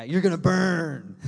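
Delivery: slow, loud hammed-up holler; mock damnation. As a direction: draw out the threat like a fire-and-brimstone parody. Acting a tactless warning of hell.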